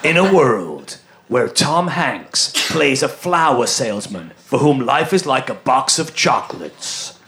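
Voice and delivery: slow, low trailer announcer; deep dramatic trailer read; movie trailer voice. Pretend. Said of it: "use the movie trailer voice".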